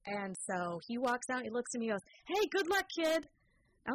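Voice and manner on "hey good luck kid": higher, louder breezy rocker; cool rocker send-off. Retold or imitated. The Eagles bassist tossing good luck at the hopeful kid, breezy. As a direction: toss it off like a laid-back rock star.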